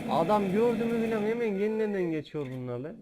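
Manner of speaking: fired-up complaint; excited rant. Real, not acted. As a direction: rant at the zombies rushing in. Excited rant about zombies.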